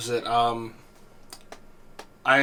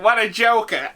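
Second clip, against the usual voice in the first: put-on old man quote; 10 semitones higher, 5 dB louder than usual. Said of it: "the old guy's like".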